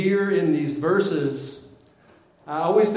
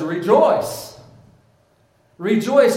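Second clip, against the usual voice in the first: loud, raised angelic proclamation; ringing announcement, higher and louder; exclaimed greeting; 7 semitones higher, 8 dB louder, slower than usual. Acted. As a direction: call it out as a heavenly herald. Angel's greeting acted out in reading.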